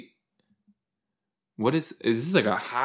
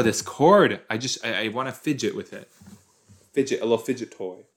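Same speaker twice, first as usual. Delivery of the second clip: normal talking voice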